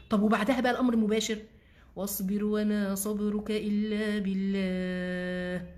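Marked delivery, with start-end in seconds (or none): 2.0-5.7 drawn-out, chant-like recitation; slow Quranic recitation. Acted. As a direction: stretch each word like tajweed.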